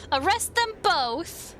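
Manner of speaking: high, slow proclamation; decisive verdict. Pretend. Player declares the verdict after weighing it, high and drawn out. Announces final verdict, higher.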